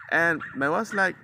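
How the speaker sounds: normal talking voice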